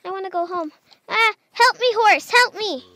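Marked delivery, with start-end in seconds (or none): 1.1-2.8 higher, louder plea for help; panicky plea. Real, not acted. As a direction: beg the horse to save you. Zombies near, begging for help.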